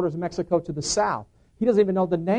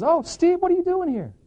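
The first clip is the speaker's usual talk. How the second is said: retold high-pitched surprise; surprised greeting; 14 semitones higher than usual. Brother greets an unexpected visitor, surprised. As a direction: greet him in a much higher voice.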